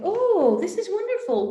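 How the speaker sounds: high, bright mock-delighted coach; gushing coach. Coach gushing over her client's dream, high mock delight. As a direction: gush over the answer like an overeager coach.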